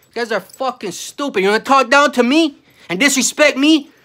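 loud, high furious rant; loud furious yelling at family who disrespect him. Real, not acted. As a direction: shout them down in real rage.

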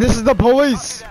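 put-on police voice; tough cop voice. Gamer playing cop to an enemy soldier, gruff voice. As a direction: announce yourself like a TV cop. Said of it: "I don't know why I like to do that voice".

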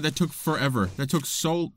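usual speaking voice

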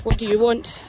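normal talking voice

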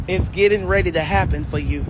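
normal talking voice

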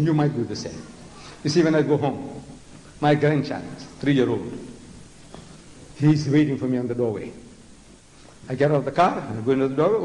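usual speaking voice

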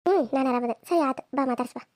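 usual speaking voice

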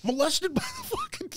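high, indignant whine; mock offended boy. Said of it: "And he's going".

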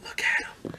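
soft whisper into his ear; teasing whisper to a laughing friend. Retold or imitated. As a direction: hiss it mockingly under your breath. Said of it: "whispering in his ear".

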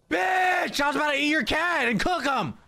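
high startled shout. Real, not acted. Player startled by a neighbour, high shout. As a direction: yell in sudden fright.

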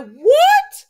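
very high, loud mock shock; shocked outburst. [name] hearing about the brother's arms, loud high shocked outburst. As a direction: explode in a high, loud question.

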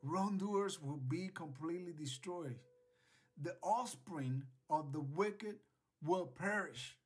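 ordinary delivery

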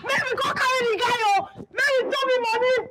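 high, taunting pidgin rant; rowdy high-pitched retort; mocking shout. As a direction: jeer at the neighbour. Heated quarrel with neighbour.

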